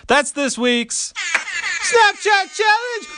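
slow, loud announcer cry climbing very high; over-the-top high-pitched sign-off; hammed-up segment announcement. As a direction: stretch it out and send the pitch soaring.

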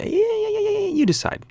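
extremely high, goofy squeal; put-on tiny squeaky voice; silly high voice. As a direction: jump way up into a playful squeak. Silly voice after discussing voices.

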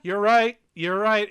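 loud, high, eager "you're right"; emphatic agreement. Real, not acted. To friends, conceding the moon was red, loud and eager. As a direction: blurt it out, suddenly convinced.

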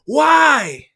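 high, loud wail of "why"; one drawn-out, desperate shout; anguished cry. A narrator's reading. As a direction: cry it out in despair. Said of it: "I cried".

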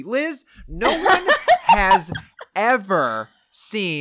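high, slow, laughing through the words; laughing disbelief. Real, not acted. Laughing disbelief at movie choice.

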